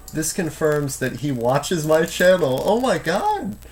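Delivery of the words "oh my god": excited amazement. Real, not acted. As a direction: gasp it out in delighted disbelief. Amazed his channel was seen.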